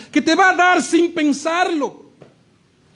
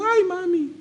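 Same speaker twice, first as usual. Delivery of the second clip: mild mock whimper of a child; a hint of a beaten child clinging to mom; crying child; 4 semitones higher, 5 dB quieter, slower than usual. Child hugging his punishing mother, lightly mock crying. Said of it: "él abrazando".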